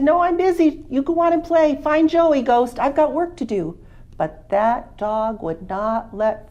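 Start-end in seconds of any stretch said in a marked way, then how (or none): 0.0-3.8 much higher, louder farmer shooing a dog; Farmer Green, busy. Acted. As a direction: brush the dog off, busy and firm.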